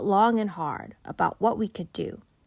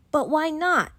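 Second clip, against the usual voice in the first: put-on protesting child; 9 semitones higher, 5 dB louder than usual. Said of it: "I protested".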